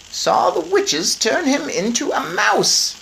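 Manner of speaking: put-on an old lady explaining calmly; grandmother's voice. Grandmother breaks odd news to the parents, high and slow. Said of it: "my grandmother said".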